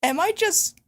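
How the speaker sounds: high puzzled voice. Retold or imitated. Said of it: "And I'm just like".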